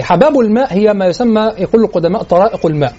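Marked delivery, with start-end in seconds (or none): none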